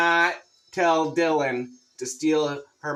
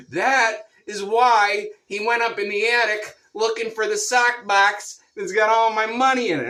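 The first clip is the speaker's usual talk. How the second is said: higher, louder put-on wife voice; high, loud impression of his exasperated wife; agitated wife; 6 semitones higher, 4 dB louder than usual. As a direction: lay it out like she's fed up with him. Wife's heated explanation, voiced.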